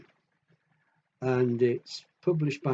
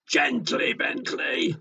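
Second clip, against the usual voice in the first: high calling shout, higher on the repeat; mum shouting; 12 semitones higher, slower than usual. Acted. Mum scolds her son, high calling shout. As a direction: mum calling out to clumsy Bentley. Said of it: "And his mum shouts".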